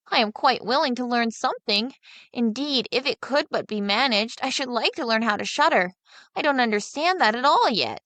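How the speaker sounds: simple younger son. Acted. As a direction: play the naive lad wondering aloud.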